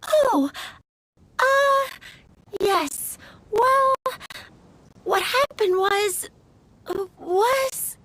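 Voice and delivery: halting, stumbling over the excuse; flustered. Acted. Florist caught out about the lost book, flustered. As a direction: stall nervously with broken-up words.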